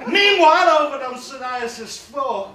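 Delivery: loud, high, slow scene change; announcer. Acted. Comic switching scenes in his story, in a big trailer-announcer voice. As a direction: boom it like a film trailer.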